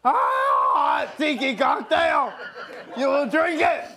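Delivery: loud, high mock German accent. Host hams a German accent for the crew. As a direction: bark it like a hammy German villain. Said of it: "Are you doing the German accent?".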